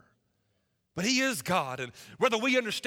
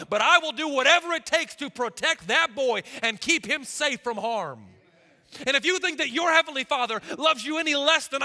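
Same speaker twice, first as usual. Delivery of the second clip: louder, driving preacher cadence; preaching at full force; 5 semitones higher, 7 dB louder than usual. Acted. Pastor pressing his congregation on God's love, preaching at full force. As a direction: pound it home to the pews.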